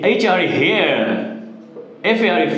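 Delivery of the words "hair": "hair" with the final R left off; silent final R. Shown as a demonstration. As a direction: teach "hair" ending in a vowel, no R. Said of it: "আমরা আর উচ্চারণ করবো না". ("hair" শব্দের শেষে র অনুচ্চারিত)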